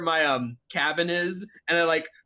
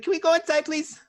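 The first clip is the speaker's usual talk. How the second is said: put-on high-pitched pleading kid; timid camper's plea; 12 semitones higher than usual. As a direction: ask your friend, high and a little small. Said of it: "look at Kira's like".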